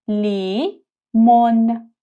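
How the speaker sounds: لیمون stretched very slowly; slow syllable demo. Shown as a demonstration. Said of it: "تلفظ این حرف ل". (لیمون آهسته و کشیده)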